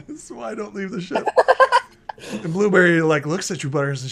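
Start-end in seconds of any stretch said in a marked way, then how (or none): 0.1-1.4 higher, fast, giggling repeat; laughing through it. Acted. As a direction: lose it halfway through the line. Same line, breaking into laughter.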